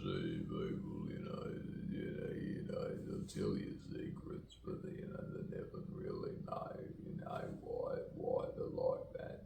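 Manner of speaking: very soft, voiceless muttered confiding; hushed, rambling whisper dying out; secretive whisper. Pretend. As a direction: fade away as if keeping a secret.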